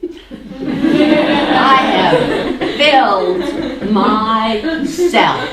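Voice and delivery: laughing loudly through the words. Real, not acted. Teacher laughing at herself to the group, laughing loudly. Laughing at own cluttered prison.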